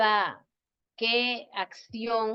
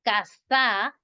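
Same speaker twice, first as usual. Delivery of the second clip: casa with a long, stressed final SA; stress on last syllable. Shown as a demonstration. Teacher repeats the wrong form for students, casa stressed on SA. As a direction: lean hard on SA in casa. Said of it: "llevara la tilde en la última sílaba".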